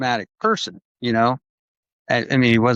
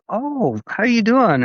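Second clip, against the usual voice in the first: mock cheerful greeting; 10 semitones higher, slower than usual. Jim greets his visitor.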